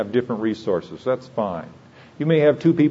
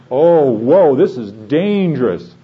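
mock alarm; 3 semitones higher, 7 dB louder, slower than usual. Acts alarmed thought at a windfall.

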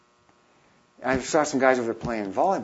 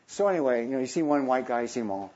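normal talking voice

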